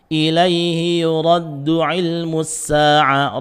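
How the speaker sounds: slow melodic tajwid chant; Quran recitation. Shown as a demonstration. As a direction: chant the verse slowly with heavy lam.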